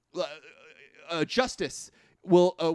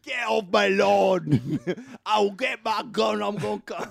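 mock grumpy old man; 4 semitones higher, 3 dB louder, slower than usual. Mocking an angry old Southerner.

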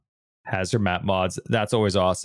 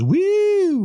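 high, loud sustained "woo"; a showy, drawn-out high woo; playful whoop; 5 dB louder than usual. Pretend. Joking about fancy piano playing, a playful whoop.

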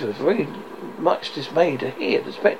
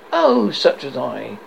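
ordinary delivery, nothing marked

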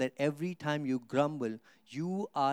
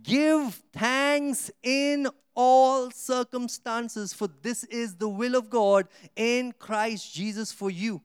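slow, higher, louder verse reading; solemn scripture reading; 7 semitones higher, 5 dB louder, much slower than usual. Acted. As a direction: proclaim "Give thanks in all circumstances" slowly and high. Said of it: "it says".

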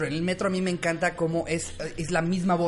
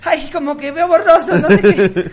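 high, loud ditzy whine; high girly voice; 8 semitones higher, 9 dB louder than usual. Retold or imitated. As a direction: complain like a dizzy fresa girl. Niña imitada ve borroso. (voz aguda de niña fresa mareada)

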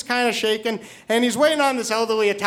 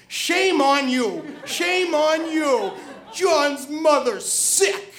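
very slow, drawn-out mock reproach; scolding; 3 semitones higher, much slower than usual. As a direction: imitate an old lady scolding, stretching every word. Old lady scolds him in the story.

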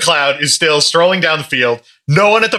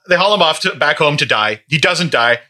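ordinary talk

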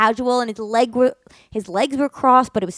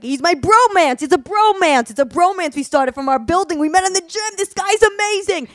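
loud bro friend; 7 semitones higher, 4 dB louder than usual. Retold or imitated. Loud friend explains the bromance, high and loud. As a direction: sell the bromance like a hyped gym bro. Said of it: "the friend is like".

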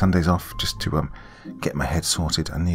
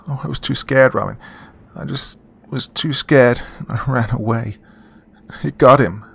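higher, louder tearful frightened voice; a hint of a sobbing young woman; tearful and scared; 6 semitones higher, 5 dB louder than usual. Sophia voiced, crying and scared.

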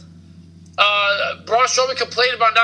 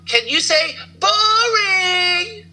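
high, slow, whining wail; mock whiny cry; 9 semitones higher, much slower than usual. Teasing co-host with a whiny cry. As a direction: drag it out like a whining kid.

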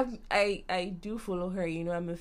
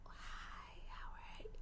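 whispered greeting, no voice at all; 16 dB quieter, slower than usual. Retold or imitated. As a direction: breathe the hello out in a whisper. Retelling a shy greeting.

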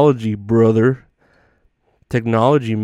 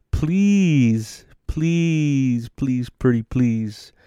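softer, pleading whine; mock begging; 4 dB quieter, much slower than usual. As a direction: beg sweetly, drawing it out. Begging Elon to unban account.

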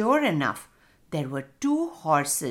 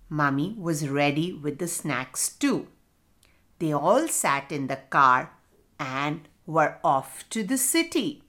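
ordinary delivery, nothing marked

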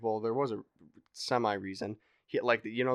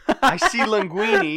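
high, loud burst of recognition; excited exclaim; 13 dB louder than usual. Real, not acted. Telling a friend, sudden recognition, excited loud burst. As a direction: blurt the name out in surprise.

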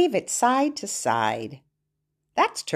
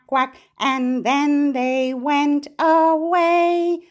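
put-on reading to a toddler; 4 semitones higher than usual. Chants the rhyme again.

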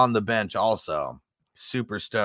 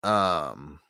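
stoner voice; 8 semitones lower, 7 dB quieter than usual. Pretend. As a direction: sound baked and spaced out. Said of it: "I'm so high right now".

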